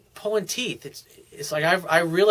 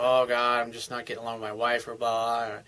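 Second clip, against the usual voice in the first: lower, softer grumble; mock weary exasperation; 4 semitones lower, 5 dB quieter than usual. Coach imitating a man fed up over his wife, weary. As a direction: give a resigned eye-roll complaint, trailing down. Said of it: "roll his eyes and go".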